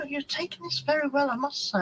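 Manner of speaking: lightly voiced doctor, mildly patronising; imitating the doctor. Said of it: "the doctor said to me".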